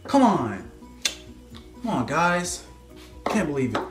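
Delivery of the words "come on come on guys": normal talking voice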